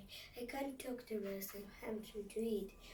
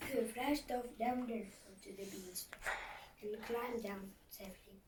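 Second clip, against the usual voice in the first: plain reading voice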